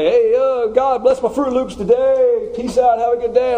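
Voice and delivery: high, breezy, too-casual voice; mock casual prayer. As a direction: toss off a prayer like a buddy. Mocking casual prayer to God.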